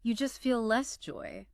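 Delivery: understated quote, slowed for weight; heavy line. Retold or imitated. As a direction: deliver a heavy line slowly, with light acting. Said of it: "well, heavy".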